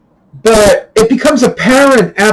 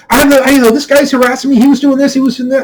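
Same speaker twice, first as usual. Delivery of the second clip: higher, whiny mock plea of the raging guy; raging guy pleading; 7 semitones higher, faster than usual. Imitating the raging guy playing victim to the crowd. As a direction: play the angry guy acting wronged.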